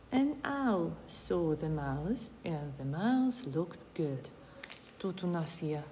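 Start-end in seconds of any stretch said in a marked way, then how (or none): 0.1-4.2 slow, clear read of the example. Shown as a demonstration. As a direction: read it out slowly for pupils. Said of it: "reading the example sentence slowly". (повільне читання прикладу)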